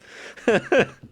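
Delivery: high, loud burst of laughter; real amused high laugh; giggling. Real, not acted. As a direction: let out a short high giggle. Laughing at own offense joke.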